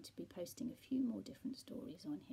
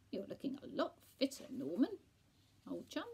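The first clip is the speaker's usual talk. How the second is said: chummy friend; 6 semitones higher, 6 dB louder than usual. A narrator's reading. Friend greeting Lawrence in story.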